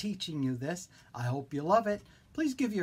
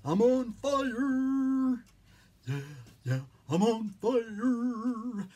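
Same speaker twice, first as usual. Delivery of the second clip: high, slow, stretched-out clown voice; silly clown; 8 semitones higher, 4 dB louder, much slower than usual. Pretend. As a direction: wail it out like a goofy clown. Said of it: "the clown voice comes out".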